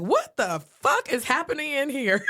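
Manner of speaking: high, stunned burst of outrage; shocked outburst. Retold or imitated. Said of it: "she was like".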